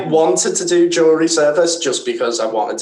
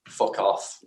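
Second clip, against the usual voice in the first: a hint of broad Scottish, low and hushed; broad Scottish accent; 4 semitones lower, 10 dB quieter than usual. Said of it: "in this real broad Scottish accent".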